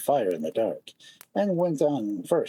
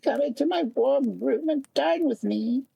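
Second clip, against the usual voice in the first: field mouse voice; 13 semitones higher than usual. A narrator's reading. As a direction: voice the kindly field mouse, pitched way up. Field mouse invites Thumbelina in.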